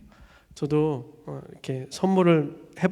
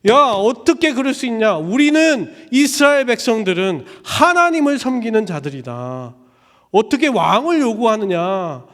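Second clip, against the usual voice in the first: loud, high scolding; angry rebuke; 6 semitones higher, 8 dB louder than usual. Retold or imitated. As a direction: scold the people. Samuel rebukes the people.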